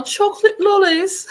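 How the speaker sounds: chocolate lollies high and loud in a hammed-up British accent; big British accent. Hamming it up for David in a British accent. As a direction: belt out chocolate lollies like a posh Brit.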